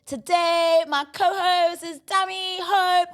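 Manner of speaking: loud, high, drawn-out hype intro; hyped announcement. Real, not acted. Host hypes up the co-host for listeners, loud and high. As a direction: announce the guest like a big reveal.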